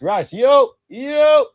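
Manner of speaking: high, loud hype call; excited shout. Real, not acted. Fan cheering Rice's run on TV, excited yell. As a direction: roar him forward as he breaks.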